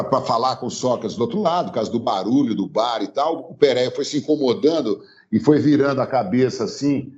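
ordinary talk, nothing marked

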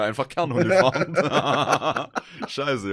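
slow, loud words through laughter; laughing. Real, not acted. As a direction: crack up while saying it. Laughs about wasted years.

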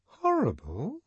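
high incredulous echo; high, shocked disbelief; astonished. Acted. Lenina astonished.

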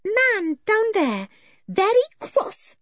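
put-on very high, slow childlike voice; little one reporting in broken phrases; childlike Eily. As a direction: speak simply in a high, drawn-out singsong.

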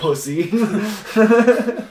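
a loud, high, mocking sneer; Death's sneer. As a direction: spit the insult down at the loser. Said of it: "and he looks at you and he says".

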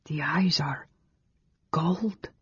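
soft, awed whisper. A narrator's reading. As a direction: whisper it in wonder. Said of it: "Carol whispered".

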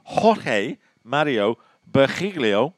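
slow careful Spanish name; Spanish pronunciation. Shown as a demonstration. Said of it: "apologies for my Spanish pronunciation".

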